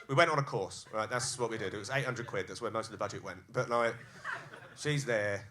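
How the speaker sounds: ordinary talk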